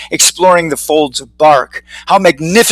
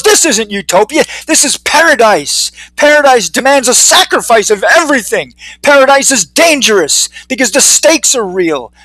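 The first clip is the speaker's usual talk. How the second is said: raised, emphatic zealot's declaration; fervent preaching; 5 semitones higher, 3 dB louder than usual. Acted. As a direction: preach it with a convert's fervour. Convert preaches about paradise.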